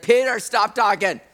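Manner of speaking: firm interruption, each order pressed hard; cutting him off. Retold or imitated. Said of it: "God just has to kinda cut him off".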